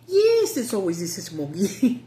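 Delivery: mock movie voice answering; movie line reply. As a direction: answer like a film character. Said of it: "I would say".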